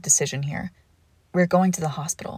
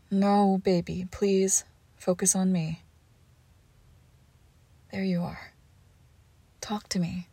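soothing. Acted. Keeping partner awake, gently.

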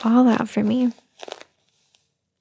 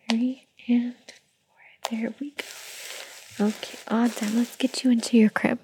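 whisper; 4 dB quieter than usual. Acted. Whispering, baby nearly asleep.

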